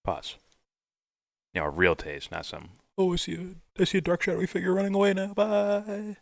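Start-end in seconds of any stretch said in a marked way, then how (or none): 3.0-6.2 high, fast airy mock voice; mock a silly vision, high and quick. Mocking a shallow trip vision.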